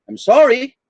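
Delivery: "I'm sorry" pitched high and rising. Shown as a demonstration. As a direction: raise your voice up on "sorry". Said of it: "with your voice rising".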